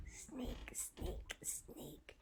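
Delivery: breathy tiptoe whisper; sneaky whisper. Acted. Narrator creeping up on the boy, whispered. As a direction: whisper each word like creeping up.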